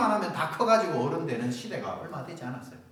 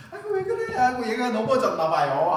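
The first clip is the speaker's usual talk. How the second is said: high, hammed-up fussing over a child; fake innocent concern; 8 semitones higher, 6 dB louder than usual. Bully covering up when mother comes, fake concern. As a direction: put on innocent worry, pitched high.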